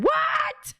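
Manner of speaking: high shouted what; a startled retold yell. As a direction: yell it in shocked disbelief. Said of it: "and I said".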